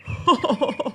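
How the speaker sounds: a gloating laugh at the dry tree; the proud tree laughs at its dying neighbour; mocking laugh. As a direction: laugh it off with scorn. Green tree gloats over dry tree.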